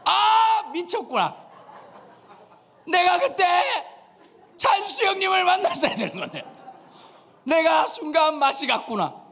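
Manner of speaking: high, loud comic wail of self-reproach; mock anguished regret. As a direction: cry out in hammed-up embarrassment. Acts kicking blankets in regret. (과장되게 높이 외치는 후회의 절규)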